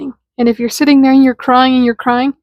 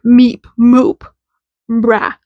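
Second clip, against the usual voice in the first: mock-angry nonsense noises; playful pretend anger; much slower than usual. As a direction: punch out silly syllables as if cross. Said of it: "angrily being like".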